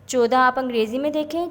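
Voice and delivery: ordinary talk, nothing marked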